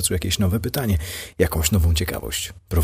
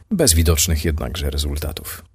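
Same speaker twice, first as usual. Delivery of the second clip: plain reading voice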